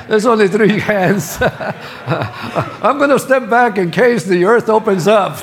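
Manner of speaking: loud, high, amused teasing; real laugh breaking into the words. Real, not acted. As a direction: joke through your own laughter. Joking at few hands raised.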